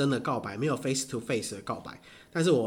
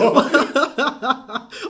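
high laugh; 11 semitones higher, 8 dB louder than usual. Real, not acted. Laughing at the teasing.

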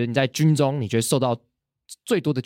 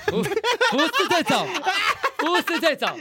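laughing. Real, not acted. Laughing denial of wrong exercise.

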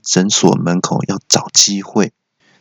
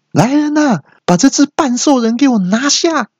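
very high, theatrical command; receptionist crying out. Acted. Receptionist ordering the half-beast seized, high mock yell. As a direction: order an arrest like costume drama. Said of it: "柜台小姐就大叫说".

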